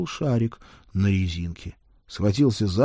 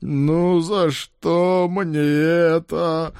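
high, very slow whimpering boss; whining; 9 semitones higher, 5 dB louder, much slower than usual. Acted. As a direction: whine it out pitifully. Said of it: "скулил".

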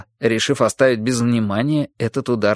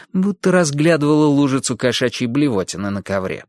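usual speaking voice

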